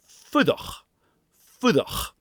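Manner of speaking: "fudach" with short oo and very short slender r; "fudach" slowly, quick tap d like the; Gaelic fudach. Shown as a demonstration. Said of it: "add that very short sound".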